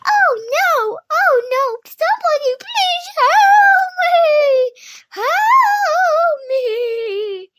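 very high, loud, wailing screams; yelling for help. A narrator's reading. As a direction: scream out for rescue, stretching each plea. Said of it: "they yelled for help".

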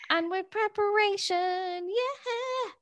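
high, slow put-on chirp; cheesy presenter. Sending up the podcast's image, high and sugary.

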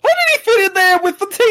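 fast, high disbelief; incredulous. Real, not acted. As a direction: blurt it out amazed. Shocked at the screen.

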